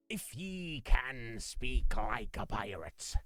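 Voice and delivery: slow growly pirate talk. Pretend. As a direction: speak it like an old sea pirate. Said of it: "a bit of piratiness in there".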